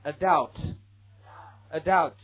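"adult" said very slowly and clearly; 慢速示范读音; slow pronunciation demo. Shown as a demonstration. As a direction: model "adult" again for repeating. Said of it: "this one".

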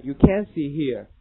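slow, "can't" with a held stop before sit; stopped t in can't. Shown as a demonstration. Teacher leading the class on can't, with a held stop. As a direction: hold the stop after can't, slowly. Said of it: "一个停顿".

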